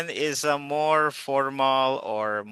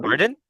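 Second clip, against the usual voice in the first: "Pardon?" with pitch sweeping upward; rising intonation; 3 dB louder than usual. Shown as a demonstration. Teacher models correct tune to students, "Pardon?" rising. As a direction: lift the end of "Pardon?" as a question. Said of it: "No, rising".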